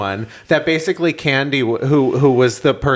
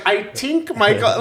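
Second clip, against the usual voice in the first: put-on Irish accent, th dropped in tink; 8 semitones higher than usual. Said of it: "She's like".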